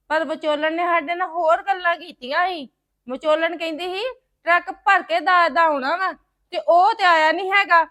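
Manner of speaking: loud, forceful complaint; angry confrontation. Acted. Son confronts in-laws over dowry.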